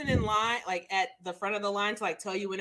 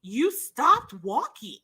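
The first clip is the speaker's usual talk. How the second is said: slow deliberate insistence; 3 dB louder, much slower than usual. Retold or imitated. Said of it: "She was like".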